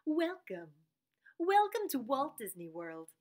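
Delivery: hushed, smooth theme-park greeter; Disney World announcer. Acted. Greeting visitors to Walt Disney World, announcer voice. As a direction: welcome them like a recorded park announcement. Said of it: "person at Disney World who, like, gives instructions".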